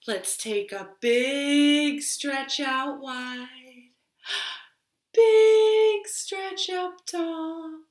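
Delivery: slow encouraging directions, big high leaps; guided stretch. Acted. Storytime host leading children in stretches, slow and playful. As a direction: coax the kids through a stretch.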